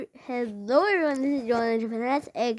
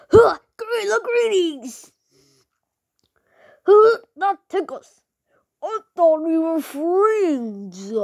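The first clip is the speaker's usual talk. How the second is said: a higher, louder whiny character voice; voicing Gus; 6 semitones higher, 5 dB louder, much slower than usual. YouTuber voices Gus, higher and whiny. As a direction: play him whiny then threatening.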